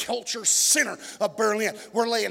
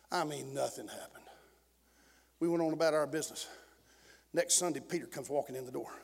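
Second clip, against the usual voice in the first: low, very soft pulpit storytelling; hushed testimony; 7 semitones lower, 10 dB quieter than usual. Real, not acted. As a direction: drop the voice and draw the church into the story.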